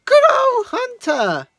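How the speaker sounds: put-on high, loud, cheery girl's voice.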